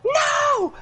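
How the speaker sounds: sudden high shouted no; a sharp shrieked refusal; scream. Acted. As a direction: scream it out in panic. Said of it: "she kinda screams".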